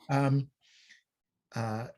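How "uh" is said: normal talking voice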